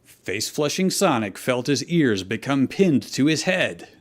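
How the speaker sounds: usual speaking voice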